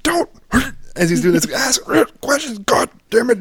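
dad barking at panicking son; retold angry yell. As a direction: cut him off furiously mid-word. Dad yells during Heimlich.

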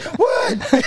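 high-pitched startled yelp; mock alarm. Kids told cops are coming, high alarmed yelp. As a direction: imitate panicked kids hearing bad news.

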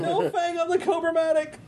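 excited announcement. Acted. GM announces defanged record player.

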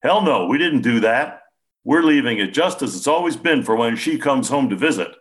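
aggravated. A narrator's reading. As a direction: snap back, annoyed. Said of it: "He looked aggravated again".

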